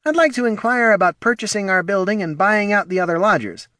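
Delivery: high, light gentlemanly voice; Polo's voice. Acted. Polo proposing a purchase to the gnome, in a high polite voice.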